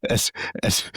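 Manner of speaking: stifled breathy giggle; half-laugh breaking through the words; Randall laughing. Acted. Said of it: "this is Randall laughing".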